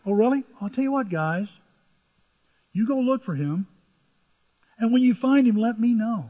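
high, loud, falsely eager king; sly Herod. Acted. Herod sweetly sends the wise men off, mock eager. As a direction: fake enthusiasm to hide his fear.

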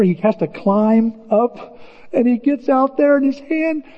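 normal talking voice

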